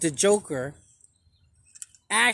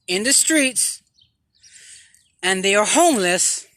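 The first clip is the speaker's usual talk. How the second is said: a lightly louder preacher's address; preaching; 5 dB louder than usual. Acted. To viewers about homeless veterans, a mild preaching voice. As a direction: proclaim it like a sermon.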